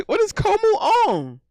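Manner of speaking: can't believe what he's seeing; shocked disbelief. Real, not acted. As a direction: pitch jumps way up in disbelief. Shocked at what the other streamer shows.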